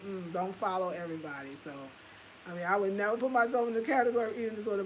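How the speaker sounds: normal talking voice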